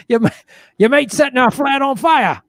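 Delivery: high, loud Aussie accusation; hammed-up Australian alarm; awful Australian accent. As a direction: imitate an outraged Australian flatmate. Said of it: "My awful Australian accent".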